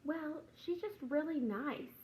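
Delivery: lightly voiced innocent kid; child's sweet answer. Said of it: "she's like".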